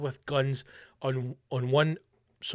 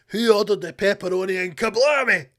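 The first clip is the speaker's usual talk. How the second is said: loud, cocky one-liner; action-hero one-liner; 4 semitones higher, 5 dB louder than usual. Retold or imitated. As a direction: deliver it like a movie hero.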